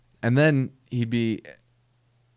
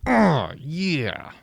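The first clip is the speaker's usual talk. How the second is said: put-on [name] accent oh yeah, higher and louder; [name]-style oh yeah; 6 semitones higher, 4 dB louder than usual. Comedian contrasting [name]'s accented oh yeah. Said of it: "But not with a accent like that".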